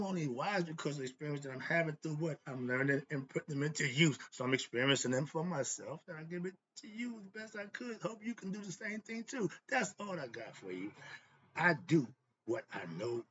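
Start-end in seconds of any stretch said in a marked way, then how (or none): none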